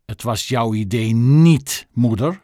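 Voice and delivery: slow, cutting snap at her mother; girl snapping angrily at her mother; angry snap. Acted. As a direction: snap at mother, furious but held in. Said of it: "snauwde Chloe ontzettend boos".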